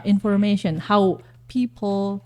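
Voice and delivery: ordinary delivery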